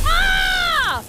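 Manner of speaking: loud high shriek; high, sudden yell; startled scream. Real, not acted. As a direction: scream at the reveal. Reacts to reveal.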